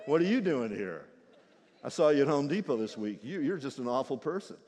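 low, judgy scolding; snooty deacon. Acted. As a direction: look down your nose at them. Snobby deacon rebukes newcomer.

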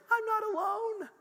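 very high falsetto exclamation; sudden realization. Retold or imitated. Pastor realizing others share his struggles, high falsetto. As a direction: squeal it out in a thin high voice.

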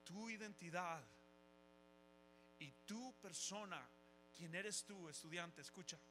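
tender blessing. Acted. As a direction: speak it gently like a blessing. Tender blessing over students, music.

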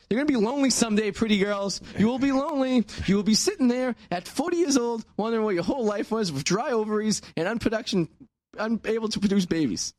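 high-pitched preachy warning; mock scolding. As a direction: warn them like a doom-saying scold. Mock warning to pretty girls.

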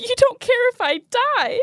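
high tearful wail; crying. Acted. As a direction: sob it out, hurt. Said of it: "Please stop crying".

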